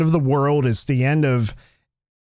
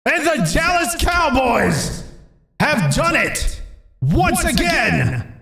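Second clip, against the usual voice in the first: high, slow shouted game call; sports announcer; 11 semitones higher, slower than usual. Acted. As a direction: call it like a big win. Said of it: "It's like Dallas just won the Super Bowl".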